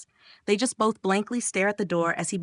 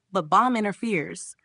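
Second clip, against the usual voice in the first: ordinary delivery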